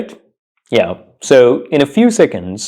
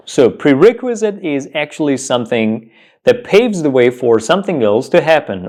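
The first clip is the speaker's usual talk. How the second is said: normal talking voice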